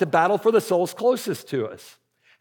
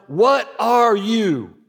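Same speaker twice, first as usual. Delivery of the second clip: slow, raised mock yell to a team; retold coach's yell; 3 semitones higher, 4 dB louder, much slower than usual. Coach to his players at camp calisthenics, mock yelling. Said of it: "yells out to them".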